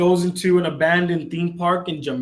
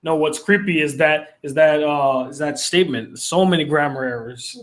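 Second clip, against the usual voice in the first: ordinary talk, nothing marked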